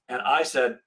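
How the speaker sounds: ordinary delivery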